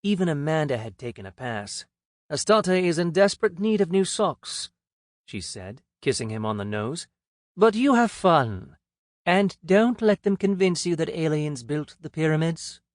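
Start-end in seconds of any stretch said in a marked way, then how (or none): 2.3-4.7 Amanda's voice. A narrator's reading. 7.5-12.8 Amanda's voice. A narrator's reading. Amanda teasing Cam goodbye.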